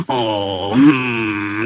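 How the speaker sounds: low, drawn-out disgruntled moan; sulky engine grumble; grumpy groan. A narrator's reading. Gordon groans at being mocked.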